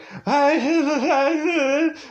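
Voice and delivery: high laughing fake sob; a hammed-up wail; half-laughing pretend cry; mock crying sob.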